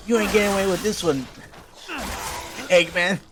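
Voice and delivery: high cartoon hero taunt; defiant Sonic. Sonic warning Eggman, high defiant hero voice. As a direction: throw it at the villain, high. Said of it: "He's like".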